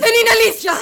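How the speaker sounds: high, very loud cries; furious shouted denial. Acted. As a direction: shout her down, repeating it. Daughter shouts down the mother. (κραυγάζει οργισμένα)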